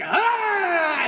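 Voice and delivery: high, sudden yell at the end; burst of energy yell. Shown as a demonstration. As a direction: explode out on the last bit. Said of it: "a big burst of energy at the end".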